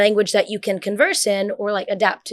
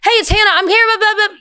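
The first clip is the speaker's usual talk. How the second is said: much higher, louder casual chirpy greeting; casual not-newscaster; 12 semitones higher, 7 dB louder than usual. Shown as a demonstration. Showing friends what a newscaster does not sound like, casual chirpy greeting. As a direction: contrast with the anchor voice, breezy and informal. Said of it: "but it's not like".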